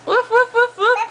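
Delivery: high, laughing woof woof; playful dog barks. Pretend. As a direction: bark like a playful puppy. Parent making dog sound for toddler.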